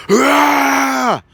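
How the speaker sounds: sudden high scream, jolted awake from a nightmare; startled cry at the voice of God; waking scream. Retold or imitated. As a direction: shriek out of a terrifying dream. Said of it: "screaming out of my dream like that".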